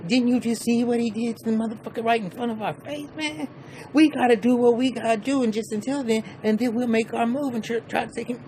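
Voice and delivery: hammed-up high-pitched Joe; high put-on voice. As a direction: play Joe pleading to wait. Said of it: "Joe goes like".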